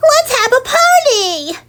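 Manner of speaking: high excited cry. Acted. Someone proposing a party, high excited cry. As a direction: burst out with a party idea.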